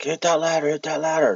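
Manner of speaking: high, pressing retold command; friend's hurried instruction, mock urgent; urgent order. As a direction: bark it up the ladder, pitched high. Friend urging during zombie dream.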